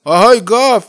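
loud high call across the water; shout. Acted. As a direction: call out to the far-off cow at full voice. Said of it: "بلند شد و داد زد".

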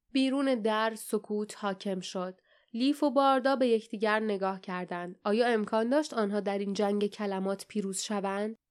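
ordinary delivery, nothing marked

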